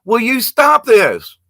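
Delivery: hammed-up fed-up demand; exasperated. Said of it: "And I'm like".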